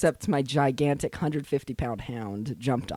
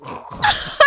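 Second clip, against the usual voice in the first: high breathy laugh; 7 dB louder than usual. Real, not acted. Laughs at hurting dog.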